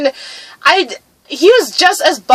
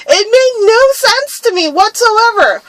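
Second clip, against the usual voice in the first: normal talking voice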